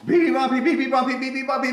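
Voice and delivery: high chirpy repeated beeps; baby bird beeping. Acted. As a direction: peep like a tiny bird.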